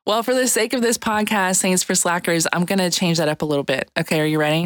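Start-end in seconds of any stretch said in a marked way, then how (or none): none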